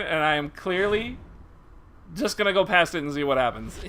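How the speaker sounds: normal talking voice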